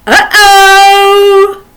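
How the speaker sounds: high, loud sing-song uh-oh; like spotting a mishap; mock alarm uh-oh; playful uh-oh. Shown as a demonstration. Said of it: "Just repeat them".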